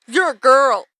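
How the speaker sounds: loud, high imitation of protest; mock indignant objection. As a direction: blurt it out like an outraged boss. Acts out Michael's objection.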